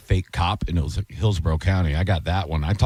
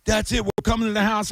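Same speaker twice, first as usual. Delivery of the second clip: high-pitched mock yell, little added volume; worked-up retelling of a scammer screaming; imitated scream; 13 semitones higher than usual. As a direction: mimic an enraged fake cop's threat. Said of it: "screaming at me".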